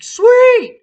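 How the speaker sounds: high, loud gleeful cheer; triumphant sweet. Retold or imitated. Drunk driver celebrating touching his nose, high and loud. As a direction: squeal it like a win.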